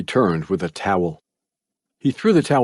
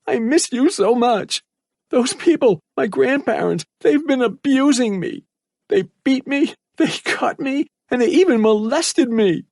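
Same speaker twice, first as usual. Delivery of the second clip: enthusiastic plea. A narrator's reading. Abused boy begs his mother to rescue him, desperate. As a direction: beg her to hurry, full of hope. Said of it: "he enthusiastically said".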